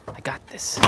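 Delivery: unvoiced self-encouragement; whispered. Real, not acted. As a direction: whisper it to yourself.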